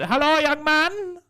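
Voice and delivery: high, loud, drawn-out greeting; imitated old customer. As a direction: call it out in a hammed-up high voice. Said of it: "little Chinese man".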